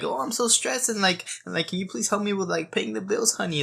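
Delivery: high mocking whine asking for help; whiny needy man. Mocking a needy boyfriend to his girlfriend, high whine. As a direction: play a helpless man begging his partner. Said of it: "it's like".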